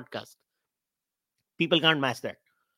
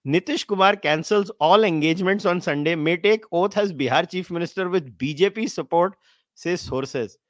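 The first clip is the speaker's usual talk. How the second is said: normal talking voice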